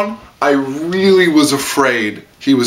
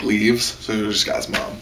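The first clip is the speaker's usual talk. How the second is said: normal talking voice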